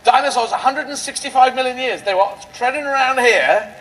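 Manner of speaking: ordinary talk, nothing marked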